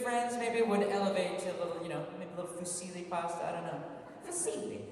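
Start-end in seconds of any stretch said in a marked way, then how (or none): 4.3-4.8 playful high word. Real, not acted. Savoring the pasta word.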